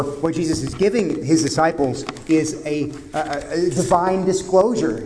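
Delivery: ordinary talk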